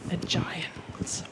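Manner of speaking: plain reading voice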